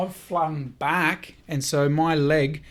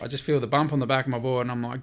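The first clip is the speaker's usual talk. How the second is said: ordinary talk, nothing marked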